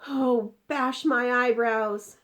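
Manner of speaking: slow, loud, high wail; distressed cry. Acted. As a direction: cry out in dismay. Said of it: "he cried".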